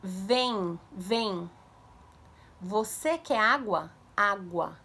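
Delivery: put-on slow, stretched words for a child; modelling for imitation. Shown as a demonstration. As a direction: sound out each word clearly, unhurried. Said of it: "ela precisa imitar a sua boca". (devagar, palavras esticadas para a criança imitar)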